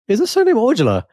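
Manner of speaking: high, eager sudden question; excited guess. Retold or imitated. Excited guess about cousin's match.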